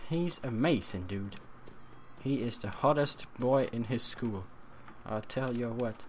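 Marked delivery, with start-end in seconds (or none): 5.1-6.0 quiet lead-in. Shown as a demonstration.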